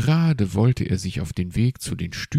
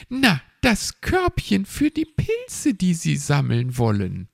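chirpy magpie; 10 semitones higher, 4 dB louder than usual. Acted. Frau Elster speaks to the owl.

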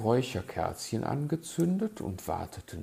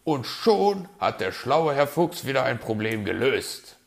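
loud, lively, pleased with himself; mildly smug, raised voice; self-satisfied fox; 4 semitones higher, 9 dB louder than usual. A narrator's reading. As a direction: announce the clever fix smugly. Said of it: "sagte er zufrieden".

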